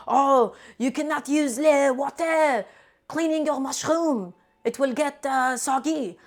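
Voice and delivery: put-on hammy French accent, slow and high; mock French chef scolding. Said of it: "allow me to do my French chef impression".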